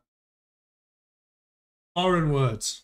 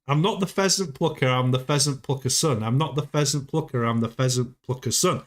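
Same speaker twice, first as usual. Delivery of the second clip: fast tongue twister; 3 semitones lower, faster than usual. Shown as a demonstration. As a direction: rattle it off quickly. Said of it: "try saying that fast".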